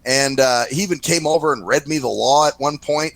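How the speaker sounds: ordinary talk, nothing marked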